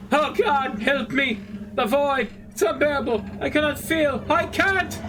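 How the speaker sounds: trapped brain. Acted. Brain in the canister begging the player, mildly anguished. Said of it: "a mechanical voice coming from the contraption speaker".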